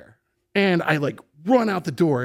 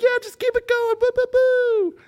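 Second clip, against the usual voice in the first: high, squeaky cheering friends. Retold or imitated. Party friends cheering on a drunk John, high and squeaky. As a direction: egg him on like a rowdy party crowd.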